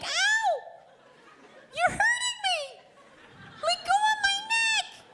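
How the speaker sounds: drawn-out falsetto mock wail; very high, slow imitation of a pained woman; lady in pain. Lady at the back of the train, hammed-up high wail. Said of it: "I hear this lady's voice".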